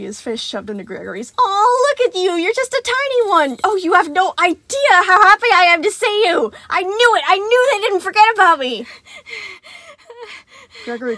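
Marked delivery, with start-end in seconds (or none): 1.4-8.9 high, loud gushing joy; overjoyed. Acted. Animatronic thrilled to finally see a child, high and loud. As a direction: spill over with happiness.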